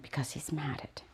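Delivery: zacht gefluisterd, onstemd; fluisterend. Retold or imitated. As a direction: fluister het als geheimpje. Retold argument with pet parent.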